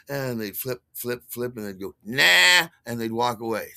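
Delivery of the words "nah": dismissive nah. Retold or imitated. Customer rejecting the program.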